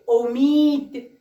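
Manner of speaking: امید drawn out slowly with a falling end; slowly modelling امید for young pupils; slow sounding out. Shown as a demonstration. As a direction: stretch امید so the opening او is heard. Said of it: "بعدی رو ببینید".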